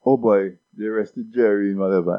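ordinary delivery

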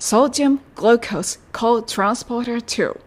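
slow, careful English demo; English pronunciation. Shown as a demonstration. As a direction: spell out Sodium glucose co-transporter two slowly in English. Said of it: "ネイティブの発音聞いてみましょう".

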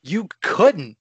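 gasping you couldn't; shocked disbelief. Acted. Shocked at patent news.

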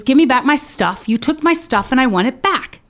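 acted-out loud, indignant insistence; agitated demand. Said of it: "clearly he was agitated".

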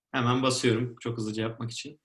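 ordinary talk

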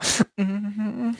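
soft, high hummed chuckle; pleased little giggle. Acted. Giant walks with tiny friend in his pocket, pleased little giggle. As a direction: chuckle softly and high to yourself. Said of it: "every so often you hear me go".

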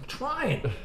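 high, exasperated whine; high whiny protest. Retold or imitated. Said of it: "I was like".

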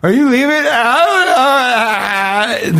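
very high, drawn-out garbled moan; slurred, stretched, high-pitched mock cluelessness; mock impaired-speech voice. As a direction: caricature of a confused driver. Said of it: "almost kind of developmentally challenged enough".

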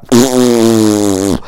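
a sharp comic sniff, acted out to show the sound; mock sniff demo. Shown as a demonstration. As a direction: sniff it in noisily, for the joke. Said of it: "like that, ladies and gentlemen".

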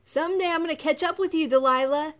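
high, fast, loud call; shout. A narrator's reading. Brother shouting after Delilah, high and loud. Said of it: "her brother Solomon shouted".

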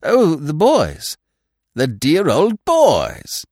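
loud, high, hearty exclamations; exuberant, drawn-out old-chum voice; jovial gushing. A narrator's reading. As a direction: gush fondly over old pals. Jovial stranger gushes over old times.

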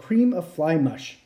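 plain reading voice

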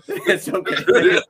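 slow, laughing "it's okay". Real, not acted. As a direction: laugh it off slowly. Laughing off the apology.

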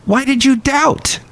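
high-pitched, incredulous chiding; acted reproachful question. Jesus questioning the disciple who sank, high-pitched reproach. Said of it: "he said".

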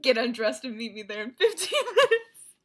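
loud, hammed-up line breaking into laughter; joking imitation through laughter; laughing mock invitation. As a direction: say it loudly, cracking up. Hamming up a suggestive retold invitation.

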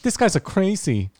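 high-pitched put-on Vietnamese accent; mock Vietnamese accent. Comic riffing as friends guess the accent, mock Vietnamese accent. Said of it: "that's Vietnamese".

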